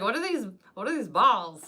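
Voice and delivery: baffled question. Retold or imitated. Newcomer asking about hush puppies, loud retold bafflement. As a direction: act out being puzzled by strange food.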